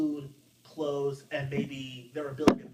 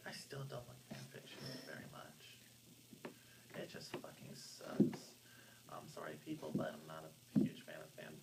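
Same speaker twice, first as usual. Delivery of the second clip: much softer, whispered confession; whispered aside; 10 semitones lower, 15 dB quieter than usual. Real, not acted. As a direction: whisper it like a guilty admission. Muttered confession about fan fiction.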